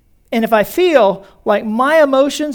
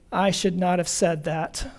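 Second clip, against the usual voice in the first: soft, slow, rueful confession; regretful quiet admission; 5 semitones lower, 7 dB quieter than usual. Retold or imitated. Congregant realizing too late, mildly rueful and hushed. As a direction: own the mistake quietly, a little slower. Regret after blurting in anger.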